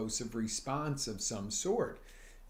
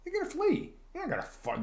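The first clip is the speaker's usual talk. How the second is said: normal talking voice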